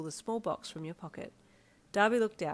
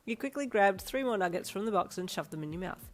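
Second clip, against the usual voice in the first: plain reading voice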